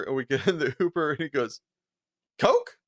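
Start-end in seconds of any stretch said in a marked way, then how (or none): 2.4-2.8 high, bright mock offer; offering coke. Teammate imitated offering coke in the Uber, high-pitched. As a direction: toss it out like a casual offer.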